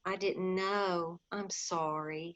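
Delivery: a small, sorry voice, taking it back; humble apology. Acted. Polly apologises to Diggory for his sick mother, softly and slowly. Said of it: "said Polly humbly".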